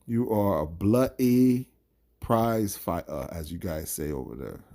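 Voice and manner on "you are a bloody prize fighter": slow, loud attempt at a British accent; a bad mock-English accent, leaning on "bloody"; terrible British accent. Vlogger apes British boxing fans, bad mock British accent. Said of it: "Excuse the terrible accent that I'm attempting".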